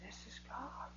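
whispered, awed; hushed awe. Retold or imitated. Guard telling the preacher about the healing, whispered in awe. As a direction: breathe it out in wonder. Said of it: "the guard said".

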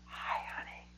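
voiceless whisper of a woman just off a ventilator; trach whisper. Retold or imitated. Wife greeting her husband after the ventilator, whispered. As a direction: breathe it out with no voice at all.

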